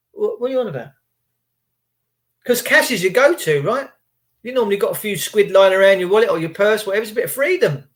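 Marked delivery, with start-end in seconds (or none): none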